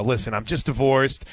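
ordinary talk

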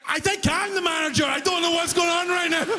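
mock-frantic clerk; panicked reply. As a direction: throw up your hands vocally. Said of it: "And I'm like".

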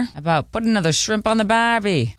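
lightly voiced, half-hearted Aussie twang; Australian accent attempt, mild; bad Australian accent. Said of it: "That was a bad impersonation".

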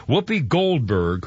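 ordinary delivery

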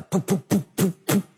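short popping puffs of a failing engine; imitated engine coughing in separate pops; sputtering engine noise. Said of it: "you hear one engine sputter".

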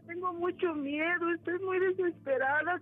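high-pitched crying plea, breaking into sobs; acted desperate sobbing; tearful pleading. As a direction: beg your sister for help through tears. Desperate sister pleading, acted scene.

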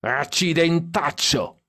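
high, drawn-out curse; drunken yell. A narrator's reading. Drunk Stan cursing from the back seat, high slow holler. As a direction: stretch out a high-pitched oath without real volume. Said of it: "urlò Stan dietro".

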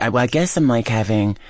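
normal talking voice

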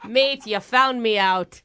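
slow, broad mock Aussie; put-on Australian accent. Caller admitting she was found out, fake Australian accent. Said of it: "Are you from Australia?".

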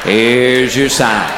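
very slow, low, dragged catchphrase; deadpan punchline. Acted. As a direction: stretch each word to milk the laugh.

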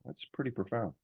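hushed reaction to Ronnie's words; awed. Retold or imitated. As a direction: let it sink in, quietly impressed. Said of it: "And I was like".

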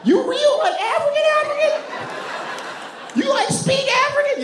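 squeaky high-pitched astonishment; mock Southerner floored by news, far above normal pitch; high surprised Southern voice. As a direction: voice shoots way up in shock, laughing disbelief. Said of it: "their voices go up really high".

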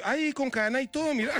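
high-pitched mock amazement; delighted marvelling. Passer-by picking up the pendant, delighted.

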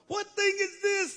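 high-pitched, astonished question; amazed onlookers. Retold or imitated. Amazed crowd questions among themselves, high astonished cry. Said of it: "they say".

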